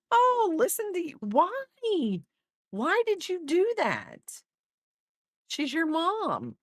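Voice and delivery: high-pitched, drawn-out reproach; dismayed scolding. Real, not acted. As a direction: scold him in a high, pleading voice. Mock scolding him for yelling at mom.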